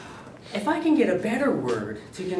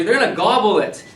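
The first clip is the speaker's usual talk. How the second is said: loud, higher "They're gonna gobble it" in delight; excited; 7 semitones higher, 9 dB louder than usual. Real, not acted. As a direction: cheer the student's word, loud. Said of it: "That's a great word".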